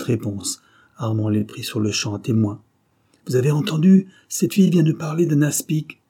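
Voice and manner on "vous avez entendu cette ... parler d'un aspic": high, loud appeal to witnesses; accusing, raised voice; urgent accusation. A narrator's reading. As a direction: call onlookers to witness an accusation. Accuser calls people to witness.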